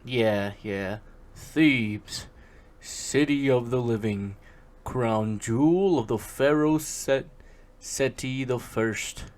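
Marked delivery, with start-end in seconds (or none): 1.3-2.3 mock Egyptian accent. Narrating the Mummy opening, mock Egyptian accent. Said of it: "I will do the Egyptian accent". 2.8-9.3 mock Egyptian accent. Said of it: "I will do the Egyptian accent".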